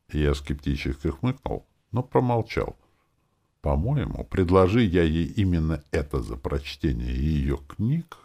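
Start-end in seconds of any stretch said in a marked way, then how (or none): none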